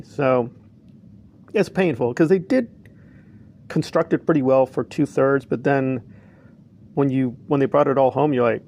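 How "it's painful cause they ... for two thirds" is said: normal talking voice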